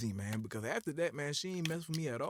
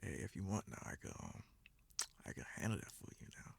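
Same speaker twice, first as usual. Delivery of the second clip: low, breathy seductive whisper; husky come-on whisper; sexy voice; 8 semitones lower, 12 dB quieter than usual. Retold or imitated. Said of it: "hit her with the voice that, uh, you know, the sexy voice".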